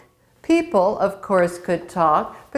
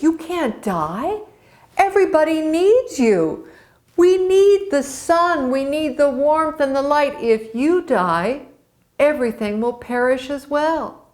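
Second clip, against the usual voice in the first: pleading mother; 6 semitones higher than usual. Acted. Frigga pleads with her son.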